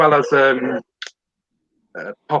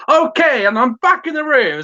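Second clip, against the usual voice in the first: much higher, louder showman switch-on; compere voice; 13 semitones higher, 4 dB louder than usual. Shown as a demonstration. Compere switching on his microphone voice when his colleague asks. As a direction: snap into stage host mode. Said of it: "do your compare microphone voice".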